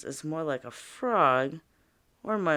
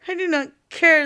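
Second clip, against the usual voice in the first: higher, louder snapping princess; snapped; 12 semitones higher, 8 dB louder than usual. Acted. Princess snaps at the frog, higher and louder. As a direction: bite it off like a spoiled child. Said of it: "the princess snapped".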